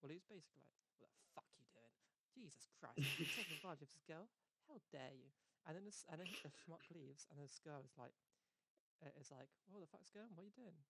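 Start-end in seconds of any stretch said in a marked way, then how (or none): 1.0-5.3 mock outrage, bursting louder midway; upper-class outrage. Upper-class man interrupts a couple, in mock outrage. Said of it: "he's meant to be, like, the, the upper class guy". 9.7-10.8 higher-pitched retold indignation; mock bewildered protest. Girl challenges the man who interrupted, in mock bewilderment.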